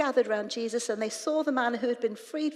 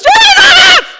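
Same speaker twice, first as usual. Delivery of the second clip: piercing, very high full scream; shouting and screaming; 26 dB louder than usual. Acted. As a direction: scream the name at him, held long. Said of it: "shouting and screaming at him".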